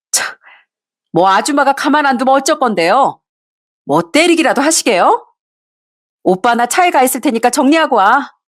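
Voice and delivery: high, mocking mistress; brazen taunting. Mistress taunting the furious wife before walking off, high and mocking. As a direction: provoke the wife coolly. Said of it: "상간녀가 끝까지 뻔뻔하게 나오는데".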